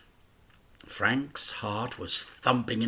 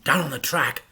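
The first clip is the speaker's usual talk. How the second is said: usual speaking voice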